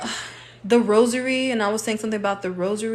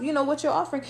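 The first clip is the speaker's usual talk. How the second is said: ordinary talk, nothing marked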